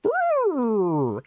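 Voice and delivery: high, drawn-out falling "ooh"; scandalized, playful "oooh" sliding down; teasing ooh. Real, not acted. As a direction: react to a spicy line with a high ooh. Reacts to spicy romantic line.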